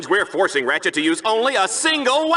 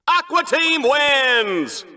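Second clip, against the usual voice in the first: slow, higher, stretched-out announcer call; drawn-out winner call; 6 semitones higher, much slower than usual. Acted. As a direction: stretch each win like a stadium announcer. Announcing the winning team.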